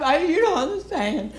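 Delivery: high, lightly tearful whine; mock crying. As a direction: imitate a weepy heckler protesting. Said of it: "Are you crying?".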